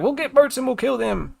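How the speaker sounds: high, put-on voice of eager would-be fighters; mock rallying cry, pitched high; villagers' war cry. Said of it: "They were like".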